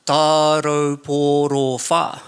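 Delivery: Tauroporofa stretched slowly into five syllables; drawn-out syllables. Shown as a demonstration. Comic explaining the show's name, Tauroporofa drawn out slowly. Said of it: "five syllables".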